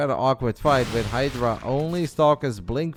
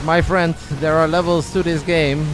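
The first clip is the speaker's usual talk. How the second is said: Gerald; 4 semitones higher, 6 dB louder than usual. Acted. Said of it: "Gerald says".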